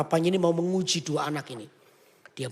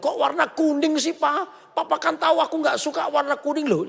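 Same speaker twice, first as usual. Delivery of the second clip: whiny complaint; 10 semitones higher than usual. Acted. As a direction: complain like a spoiled kid. First child complains about yellow dress.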